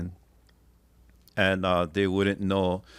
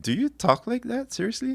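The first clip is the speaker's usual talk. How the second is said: much higher, mock disbelief; incredulous question. Mocking stiff newspaper language, high incredulous question. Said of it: "It's like".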